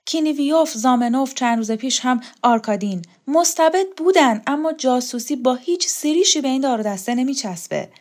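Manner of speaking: lightly acted urgency, voice lifted; agitated story character.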